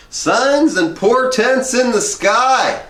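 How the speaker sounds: high, slow booming declaration; portentous proclamation. Retold or imitated. Del Close rambling to the narrator, booming portentous cry. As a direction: proclaim it like a mad prophet.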